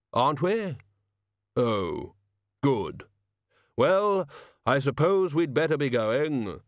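slow, deliberate solemn figure; slow heavy voice. Acted. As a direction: weigh each word heavily. Hooded figure talking.

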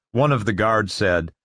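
ordinary delivery, nothing marked

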